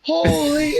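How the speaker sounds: very high, loud exclamation, cut off; freaking out. Retold or imitated. Advisor freaking out over the famous buyer, yelling. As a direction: let it burst out in shock. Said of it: "he starts freaking out".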